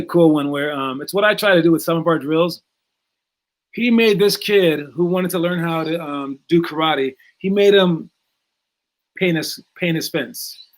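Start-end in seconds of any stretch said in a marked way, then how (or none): none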